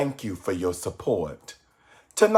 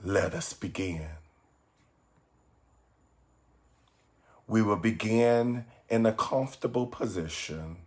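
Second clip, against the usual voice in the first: softer, measured meditation voice with long pauses; guided meditation; 3 semitones lower, 7 dB quieter than usual. Acted. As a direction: guide listeners gently inward, phrase by phrase.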